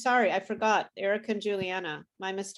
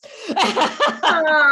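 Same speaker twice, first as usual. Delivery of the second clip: laughing; 7 semitones higher, 9 dB louder than usual. Real, not acted. Teacher laughs at praise joke.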